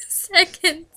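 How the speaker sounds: usual speaking voice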